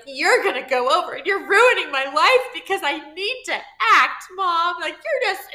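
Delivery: put-on loud, high, whiny teenage outburst at mom; teen tantrum. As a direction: replay the yelling fit of a sixteen-year-old. Teen arguing with mom, slams door.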